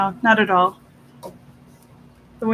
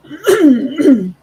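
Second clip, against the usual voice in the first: throat clearing; 6 semitones higher, 10 dB louder than usual. Real, not acted.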